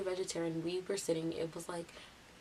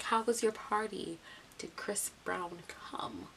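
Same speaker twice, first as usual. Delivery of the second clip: deliberate repeat; slower than usual. Retold or imitated. Ralph repeating himself after her "huh", slow and deliberate. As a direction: repeat it pointedly, easing down and stretching out.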